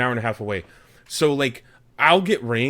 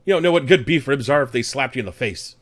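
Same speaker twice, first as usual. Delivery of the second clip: mock scolding; 3 semitones higher, 4 dB louder than usual. Playful barbecue argument.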